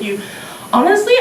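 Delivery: usual speaking voice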